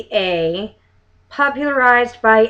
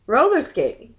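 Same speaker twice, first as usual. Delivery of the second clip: ordinary talk, nothing marked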